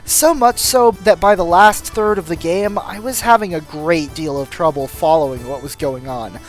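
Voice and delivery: usual speaking voice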